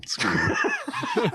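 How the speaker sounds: high squealing laugh; laughing helplessly at the phone; a sudden high-pitched laugh; burst of laughter. Real, not acted. Phone goes off mid-show.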